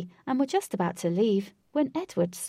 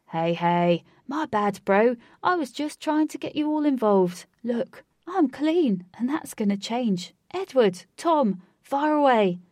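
louder, higher appeasing host; the party host backing down to an angry guest; apologetic host; 4 semitones higher, 6 dB louder than usual. A narrator's reading. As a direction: soothe him then call the elephants. Host apologising then ordering.

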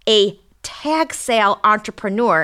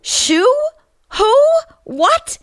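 surprised; 5 dB louder, much slower than usual. Acted. Pun leaves her baffled.

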